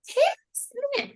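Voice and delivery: put-on very high, drawn-out child's scolding; children mimicking teacher. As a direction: copy the teacher's call-out in a slow, squeaky kid voice. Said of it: "you hear the other children saying it".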